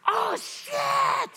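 very high, loud startled yell; retold shocked shout. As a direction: blurt it out as the power hits. Healed guy shocked mid prayer.

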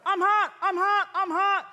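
high, loud cry of I'm hot, over and over; retold outcry. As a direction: call it out like heat is rushing through you. Healed guy cries out again.

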